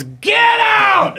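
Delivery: high, explosive bellow; retold furious shout. Director throwing a guy out of the bathroom, furious shout. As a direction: yell it as the enraged director clearing the room.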